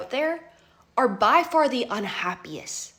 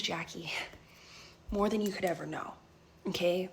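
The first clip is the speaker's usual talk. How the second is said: softer, lifeless voice, quicker; hollow and drained; 4 semitones lower, 8 dB quieter than usual. Retold or imitated. Heartbroken friend at the door, hollow and much softer. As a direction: voice of someone emptied out, much softer.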